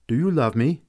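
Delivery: ordinary delivery, nothing marked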